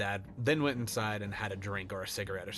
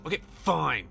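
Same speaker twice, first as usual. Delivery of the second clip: reluctant; 7 semitones higher, 5 dB louder than usual. Acted. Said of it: "Charlie said".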